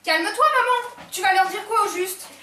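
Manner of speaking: high, loud plea; urgent shout. Acted. Daughter stopping her frantic mother, loud and urgent. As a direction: cut through her mother's panic.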